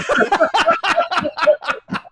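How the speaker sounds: loud, high cackling laugh; big burst of laughter. Real, not acted. Laughing at his own census story, loud cackle. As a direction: explode into laughter.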